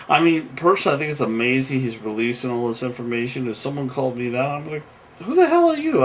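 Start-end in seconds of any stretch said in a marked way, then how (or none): 5.2-6.0 high, loud mock outrage; acted burst of indignation; indignant retort. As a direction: challenge a nosy caller, pitched way up. Acted indignant reply to nosy caller.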